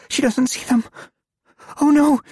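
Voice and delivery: high, loud panicked cry. Acted. Girl panics seeing the threat.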